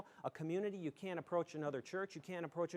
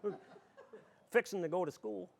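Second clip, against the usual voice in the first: Southern Tier dialect. Shown as a demonstration. Speaker shows the audience how Frewsburg talks, Southern Tier dialect. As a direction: demonstrate hometown Frewsburg country speech. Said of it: "we'd say stuff like that".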